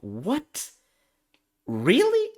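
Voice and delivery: high rising pitch of disbelief; incredulous. Retold or imitated. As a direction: question it in mock disbelief, voice shooting up. Retells his disbelief at arbiter's ruling.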